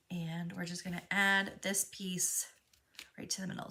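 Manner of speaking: soft whisper to herself. Real, not acted. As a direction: breathe it under your breath while working. Aside while gluing the piece.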